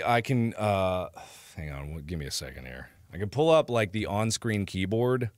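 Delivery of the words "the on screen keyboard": usual speaking voice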